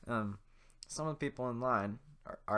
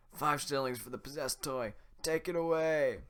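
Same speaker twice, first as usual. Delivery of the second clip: guard voice; 6 semitones higher, 7 dB louder than usual. Acted. Corrected take.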